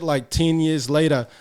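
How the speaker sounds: ordinary delivery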